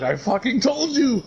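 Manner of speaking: high, drawn-out told-you-so; stretched, high mock outburst, near laughing; mock vindicated cry. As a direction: imitate a man crying out his vindication. Acted triumph over the body.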